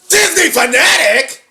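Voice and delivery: real high, loud shout of surprise; excited shout. Real, not acted. Viewer spotting a fan's name on screen, excited shout.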